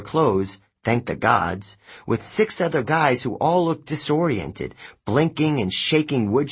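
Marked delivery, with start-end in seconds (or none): none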